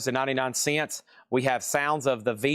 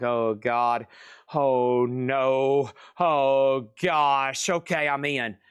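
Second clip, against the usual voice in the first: mock nervous gasps; much slower than usual. Nervous bidder voiced for yellow.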